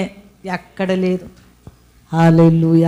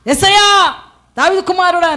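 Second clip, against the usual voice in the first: crying out; 12 semitones higher than usual. Retold or imitated. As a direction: shout the plea upward. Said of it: "అయితే గుడ్డివాడు".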